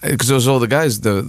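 ordinary talk

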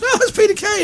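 high-pitched mock fan squeal; excited crowd. Crowd seeing Peter come on, mock excitement. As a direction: imitate fans recognising a star. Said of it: "Everyone goes".

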